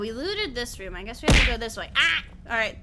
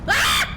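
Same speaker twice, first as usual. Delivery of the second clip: sudden loud scream; startled scream; 8 dB louder than usual. Real, not acted. Streamer startled by a monster, screaming. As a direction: yelp in fright.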